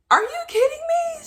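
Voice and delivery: very high, incredulous squeal; escalated disbelief. Retold or imitated. Patient questions her doctor again, very high incredulous disbelief. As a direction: pitch leaps up in shocked disbelief.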